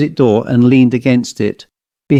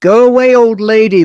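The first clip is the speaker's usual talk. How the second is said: high, loud doll voice; outrageous quacking doll; 9 semitones higher, 4 dB louder than usual. A narrator's reading. Doll drives off an old lady, quacking outrageously. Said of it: "the doll quacked outrageously".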